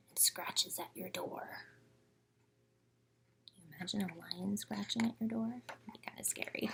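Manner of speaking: scary bedtime whisper; spooky whisper. A narrator's reading. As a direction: whisper it to make them shiver. Spooky lion scratching at door.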